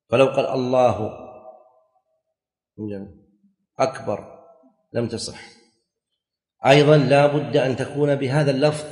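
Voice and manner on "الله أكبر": long silence between الله and أكبر; broken by pause. Shown as a demonstration. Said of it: "أن يأتي بها مواليا". (وقفة طويلة بين الله وأكبر)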